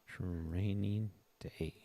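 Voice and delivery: lingering, softly drawled repeat; drawn-out echo. Real, not acted. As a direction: draw each word right out. Playful echo of his remark.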